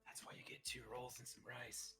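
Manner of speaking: whispering. Real, not acted. Aside about sushi.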